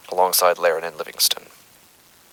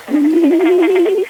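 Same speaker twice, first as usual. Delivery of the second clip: distorted interference; 9 dB louder than usual. Acted. Investigator's recording hijacked by interference, warped glitching voice. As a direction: voice warped by eerie interference, much higher and louder.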